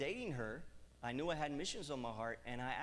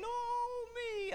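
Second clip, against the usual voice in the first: high, louder mock wail; mock whining; 5 dB louder than usual. Preacher retelling his whining alone in the cold trailer. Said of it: "in the midst of my saying".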